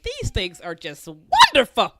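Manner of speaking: slow gush, loud on WONDERFUL; gushing delight. Retold or imitated. Friend raving about Adidas slides, gushing delight. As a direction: savor each word and burst out on "wonderful".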